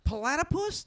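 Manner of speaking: high, bouncy accent on Platypus?; funny platypus. Real, not acted. Friend begs to hear the word again, high accent. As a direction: sing out Platypus? high and rising. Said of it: "Say that word again".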